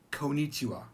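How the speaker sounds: konnichiwa as ko-nichiwa, one quick n; single short n. Shown as a demonstration. Teacher showing the mistake, konnichiwa with one n. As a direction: slide over the n in konnichiwa. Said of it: "It's not".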